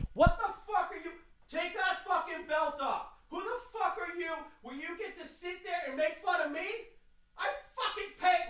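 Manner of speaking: high, strained furious rant at the TSA agent; furious tirade. Retold or imitated. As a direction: let it rip, voice cracking high with outrage. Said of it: "I let off a fucking tirade".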